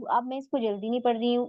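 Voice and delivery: usual speaking voice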